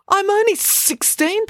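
put-on boy protesting his age. A narrator's reading. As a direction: squeak out the protest. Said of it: "protested Joe".

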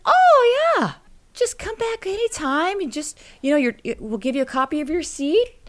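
high folksy reassurance, no worries; trusting store owner. Retold or imitated.

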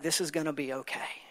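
put-on soft whisper to a tearful child; whispered reassurance. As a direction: breathe it gently, calming her. Father reassuring tearful daughter.

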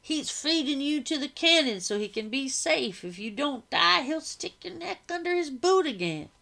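loud, high and scornful; mocking laugh-talk. A narrator's reading. Freed man mocking the enlisted slave, loud and scornful. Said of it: "The man laughed louder".

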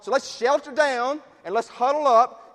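rally everyone to take cover; acted alarm of a huddling churchgoer; urgent rallying. Voicing churchgoers retreating from danger.

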